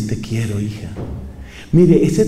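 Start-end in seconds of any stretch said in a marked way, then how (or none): 0.0-0.9 softly voiced loving father, much quieter; a hint of a father's tender whisper of love. Preacher voicing a father telling his daughter he loves her, much softer.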